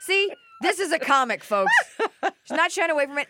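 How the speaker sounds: delighted laughing approval; laughing praise. Real, not acted. Host laughing while praising the comic to the audience.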